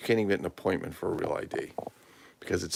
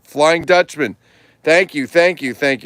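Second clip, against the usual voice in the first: loud, high exclaim; excited; 9 semitones higher, 14 dB louder, slower than usual. Real, not acted. Thanking viewer Buck for the answer, excited. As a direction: cheer the answer out.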